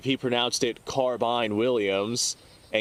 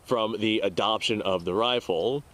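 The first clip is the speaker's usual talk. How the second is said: normal talking voice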